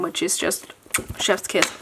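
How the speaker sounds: soft unvoiced whisper. Real, not acted. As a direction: whisper it with relish. Savouring a favourite washi strip.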